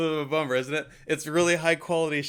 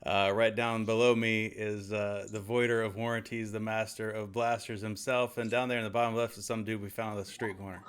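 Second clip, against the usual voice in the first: show host; 3 semitones lower, 3 dB quieter than usual. Pretend. Host introduces show hosts.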